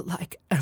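stray whispered noise; odd vocal noise. Shown as a demonstration. Coach experimenting with a weird noise. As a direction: toss off a strange mouth sound. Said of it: "sorry, I don't know what that was".

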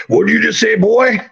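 high, mock threatening old guy; hammed-up threat from a heavy older man; menacing old man.

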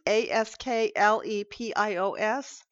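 A S K L E P I O S spelled letter by letter; spelling out the name. Shown as a demonstration. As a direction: spell out Asklepios one letter at a time.

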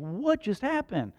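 high, drawn-out disbelief; mock shock. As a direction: act out a slow, startled reaction. Dad reacting to daughter's bruised-looking face.

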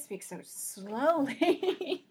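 exaggerated, very slow drawl on the words; teasingly stretched-out slow delivery; mock slow talk. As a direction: drag it out to mock slow talkers. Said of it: "she's like".

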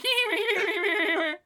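very high, held cartoon giggle; imitated constant giggling. Podcaster mocking the comic sidekick mouse, high-pitched imitated giggle. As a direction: squeal a mock sped-up chipmunk laugh. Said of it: "he's constantly laughing".

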